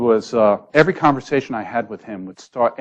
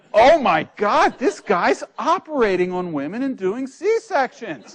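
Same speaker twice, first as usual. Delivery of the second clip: alarmed; 12 semitones higher, 3 dB louder, slower than usual. Retold or imitated. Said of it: "and I'm going".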